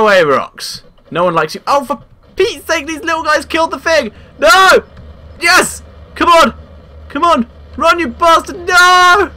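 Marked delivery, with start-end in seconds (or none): 1.7-9.3 frantic high panicked yelling; being chased. Real, not acted. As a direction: shout in panic as it catches you. Rocks chasing, dino dying.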